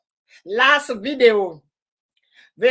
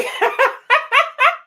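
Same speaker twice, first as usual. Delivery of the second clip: real delighted high laughter; high burst of laughter; 13 semitones higher than usual. Real, not acted. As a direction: crack up at the memory. Laughing at friend's video.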